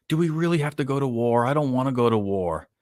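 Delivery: high-pitched imitation of a pleading orc; mock begging orc. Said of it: "The orc that kinda just begged for n".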